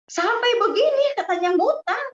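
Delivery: high, loud incredulous cry; retold outburst. Preacher retells the husband's reaction to debts, high outburst.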